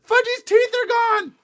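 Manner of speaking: very high, loud, slow shriek; horrified scream. A narrator's reading. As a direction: scream the dreadful news, drawn out. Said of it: "Sheila screamed".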